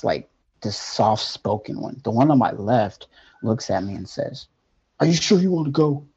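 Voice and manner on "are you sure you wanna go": a hint of a stern, fast question; lightly voiced stern angel, higher. Angel on the left asks if he wants to go, stern. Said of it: "in a stern voice".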